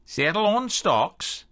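British receptionist answering the phone; British accent. A narrator's reading. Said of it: "Rosemary's British accent".

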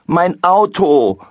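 put-on boy wailing about his car; wailing shout. A narrator's reading. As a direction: howl over the broken toy. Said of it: "schreit er".

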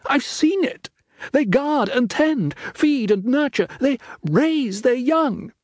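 put-on high, earnest young girl's voice; pleading daughter Faith. Faith pleads with her father.

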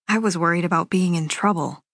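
ordinary delivery

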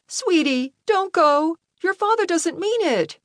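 high, anxious motherly plea; pleading mother. A narrator's reading. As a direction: beg your child to stay. Mother begs son to stay.

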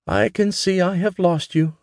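mother teasing her daughter about the sewing machine. A narrator's reading. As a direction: tease her fondly. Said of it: "Mama teased".

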